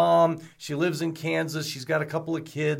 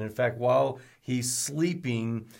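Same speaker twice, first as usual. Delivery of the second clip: ordinary delivery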